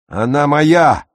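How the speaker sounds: put-on boy crowing his claim; triumphant shout. A narrator's reading. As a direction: yell it over the beaten brother. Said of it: "крикнул он".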